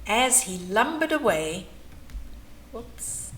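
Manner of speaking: usual speaking voice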